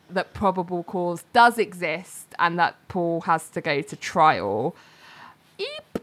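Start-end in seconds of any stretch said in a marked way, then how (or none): 5.6-6.0 high, soft little eep; mock alarmed squeak; tiny squeak. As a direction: squeak like a startled mouse. Reacting to trial verdict.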